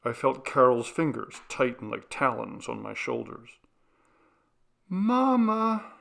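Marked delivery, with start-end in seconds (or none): 4.9-5.9 high, drawn-out eerie little voice; a ghost girl calling from underwater; ghost child. A narrator's reading. Ghost of the little sister calling to mother, high eerie. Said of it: "said the little girl".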